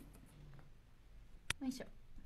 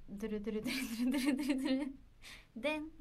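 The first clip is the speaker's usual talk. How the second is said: quick spoken drumroll; rapid drumroll noise to tease a reveal; vocal drumroll; 4 dB louder, much faster than usual. Pretend. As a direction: imitate a fast drumroll, then a hit. Drumroll before announcing tomorrow's member.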